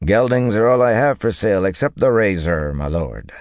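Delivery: "razor" given a little extra weight; dealer's reply with "razor" gently emphasized; slight stress on razor. A narrator's reading. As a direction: lean lightly on the word "razor". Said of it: "emphasizing the word razor a little".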